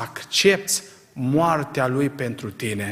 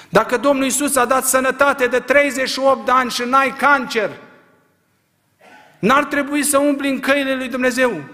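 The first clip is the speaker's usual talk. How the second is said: full-voiced preaching, higher and louder; fiery preaching; 8 semitones higher, 5 dB louder than usual. Preacher rousing his congregation, full-force preaching. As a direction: preach it hard to the whole church.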